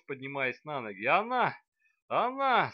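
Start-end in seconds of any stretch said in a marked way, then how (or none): 1.1-2.7 high, loud furious hissing; angry hiss. Acted. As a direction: spit it out like an angry cat. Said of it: "со злом он зашипел". (злобно шипя, как кот)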